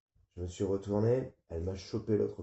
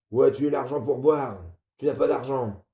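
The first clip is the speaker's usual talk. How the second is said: loud, higher scolding father; angry father; 5 semitones higher, 9 dB louder than usual. Acted. Father scolding his son about money for drink. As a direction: tear into him for drinking.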